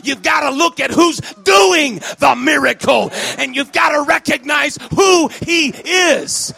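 forceful preaching, building in volume and pitch; volle prediking. Acted. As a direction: drive each phrase home to the congregation.

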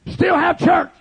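impassioned, shouted revival sermon; full-force preaching. Acted. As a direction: preach it hard to a shouting congregation.

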